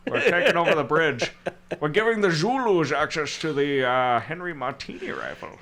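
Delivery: loose Scottish-tinged Sean Connery voice; Sean Connery impression. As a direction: drop into a lightly done Connery accent. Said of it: "my Sean Connery impression".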